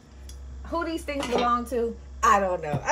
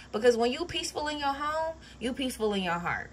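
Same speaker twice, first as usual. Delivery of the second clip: usual speaking voice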